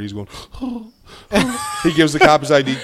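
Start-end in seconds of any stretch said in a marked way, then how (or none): 0.3-0.8 high, soft mock gasp; comic high-pitched wheeze; hard breathing imitation. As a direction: imitate nervous heavy breathing. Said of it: "he's breathing hard".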